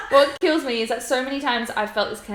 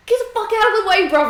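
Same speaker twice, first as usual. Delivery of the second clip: high, loud, rapid driver's yell; hammed-up outburst behind the wheel; mock road rage; 10 semitones higher, 7 dB louder, faster than usual. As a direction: yell at traffic, playing it up. Retold shouting at drivers while driving.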